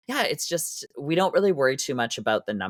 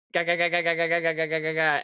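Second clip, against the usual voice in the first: quick repeated ga ga noises, a bit higher; wiping sound effect; 5 semitones higher than usual. Retold or imitated. Miming wiping glue off her face for the class, rhythmic ga sounds. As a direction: mimic frantic wiping with a rhythmic ga.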